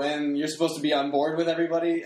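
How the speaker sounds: ordinary talk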